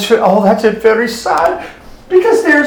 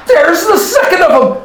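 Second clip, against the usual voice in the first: higher-pitched storytelling outburst; dramatic exclamation; 6 semitones higher than usual. Acted. Robin pointing at the cook, high dramatic exclamation.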